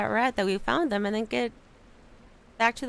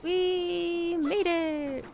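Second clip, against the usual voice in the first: higher and very slow, stretched cheer; drawn-out triumph; 7 semitones higher, much slower than usual. Real, not acted. Player reaching the far shore, stretched triumphant cheer. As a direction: savour the arrival. Arriving after long walk.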